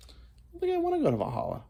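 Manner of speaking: high, fast mock-whiny reply; high fast squeak. Answering the stranger in a high, fast squeaky voice. His own reply in story.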